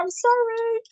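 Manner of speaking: very high, cutesy mock apology; sheepish apology. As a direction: say sorry in a teasing sing-song. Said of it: "I'm like".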